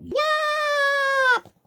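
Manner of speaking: very high piercing little shout; one tiny boy's big yell; shouted yop. Acted. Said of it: "he shouted out".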